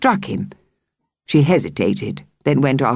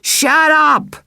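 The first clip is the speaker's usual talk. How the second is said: high, loud furious shout; angry yell; 13 semitones higher, 4 dB louder than usual. Acted. Girl screams at the taunting children, high and loud. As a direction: yell it and throw the stone.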